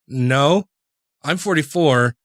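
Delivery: normal talking voice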